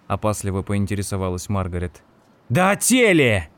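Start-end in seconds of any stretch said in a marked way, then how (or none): 2.5-3.5 higher, louder yell. A narrator's reading. Ed yells at Margaret about the body, loud and high. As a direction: yell it out in frustration. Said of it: "заорал Эд".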